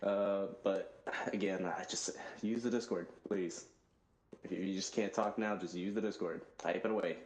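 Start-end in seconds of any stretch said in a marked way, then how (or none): none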